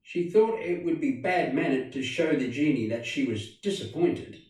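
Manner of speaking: ordinary delivery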